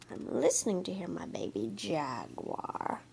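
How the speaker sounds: soft hushed whisper. Acted. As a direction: whisper it while listening for the cub. Mother jaguar listening for baby.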